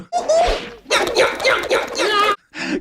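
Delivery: a high, full burst of laughter; big laugh. Real, not acted. Co-host laughs at his own dumb watch joke, with a high burst of laughter. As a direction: crack up at your own joke.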